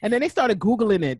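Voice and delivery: usual speaking voice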